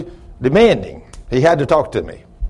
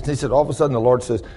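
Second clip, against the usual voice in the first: ordinary talk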